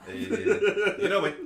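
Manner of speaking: slow, laughing through the words. Real, not acted. Player to friend about a bad joke, said with a slow laugh. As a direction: chuckle it out, dragging each word.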